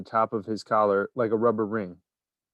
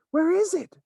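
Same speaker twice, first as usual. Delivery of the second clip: much higher crowd shout. A narrator's reading. A bystander shouting for a look, much higher. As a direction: call out from the crowd. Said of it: "somebody shouted".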